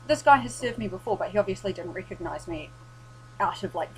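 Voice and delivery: ordinary talk, nothing marked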